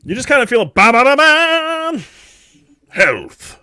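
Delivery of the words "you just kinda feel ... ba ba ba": game sound effect. Retold or imitated. DM imitates video game sound.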